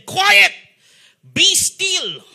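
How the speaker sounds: high, loud command to the storm; commanding rebuke. Acted. Jesus commanding the storm to be still, loud and high. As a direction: order the wind to stop. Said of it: "he rebuked the wind and said".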